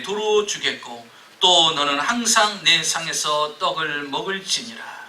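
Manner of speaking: loud, solemn Bible reading; scripture reading. Acted. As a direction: proclaim the verse.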